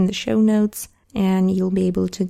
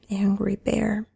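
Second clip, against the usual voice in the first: normal talking voice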